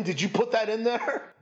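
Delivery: ordinary delivery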